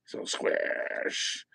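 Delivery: ordinary talk, nothing marked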